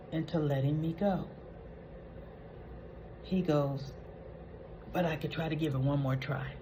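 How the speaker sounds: low, hushed musing; pensive self-talk. Retold or imitated. Brad weighing whether to blackmail her, pensive and subdued. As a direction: think it through quietly to yourself.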